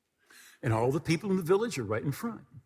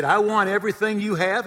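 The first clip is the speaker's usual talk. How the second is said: loud, raised, eager plea; eager demand; 7 semitones higher, 9 dB louder than usual. Retold or imitated. New convert asking missionary for the Holy Spirit, loud and eager. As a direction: ask for it all with fervor.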